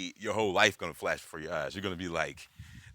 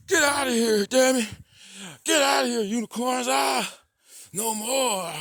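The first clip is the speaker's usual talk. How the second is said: very high, loud strained shouts; frantic yelling; 12 dB louder than usual. Acted. Man acting out banishing the unicorns, frantic high shouting. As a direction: shriek it in bursts, trying to drive them off.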